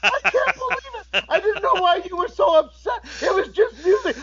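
very high mock crying; imitated tearful wail. DJ learning it was all staged, hammed-up crying. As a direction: wail like the upset victim of a prank. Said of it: "she got tears in her eyes".

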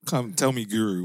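ordinary delivery

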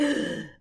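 a sharp, high intake of breath; startled gasp. Retold or imitated. Sharp high gasp at the father-in-law's question. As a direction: gasp as if caught off guard. Shocked at being asked for sauce.

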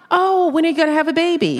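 lightly mocking intrusive asker; mock nosy question. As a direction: rattle it off like a busybody.

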